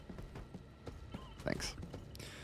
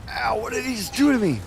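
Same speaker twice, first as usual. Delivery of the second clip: loud, high startled cry; startled yelp; 12 semitones higher, 3 dB louder than usual. Real, not acted. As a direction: yelp at the sudden hit. Hit by monster, loud.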